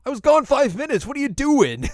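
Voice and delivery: high, loud squawk of protest; exasperated cartoon yell; mock indignant yell. Inuyasha runs back to mourners, mock indignant yell.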